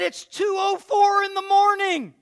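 high, loud and slow; slow excited shout. Retold or imitated. Father telling mother the clock time, shouting. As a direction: announce it like a miracle.

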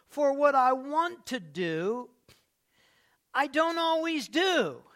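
loud, high, slow reading of the verse; emphatic scripture. Real, not acted. Pastor proclaiming Paul's words, loud and slow. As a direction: stretch it out and lean on every word. Preacher quoting Romans.

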